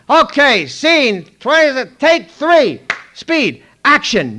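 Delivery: loud high set call; slate call. Retold or imitated. Clapper girl slates take three, loud high call.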